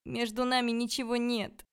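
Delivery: high, pleading denial; plaintive shout. A narrator's reading. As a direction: cry the denial out pitifully. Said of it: "крикнула я жалобно".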